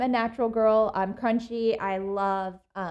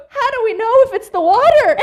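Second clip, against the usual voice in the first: much higher, louder mimic of a flustered mom; anxious mom; 12 semitones higher, 13 dB louder than usual. Imitating mom pestering the nurse with questions. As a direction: squeak the questions like a frantic mother. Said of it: "Mom goes".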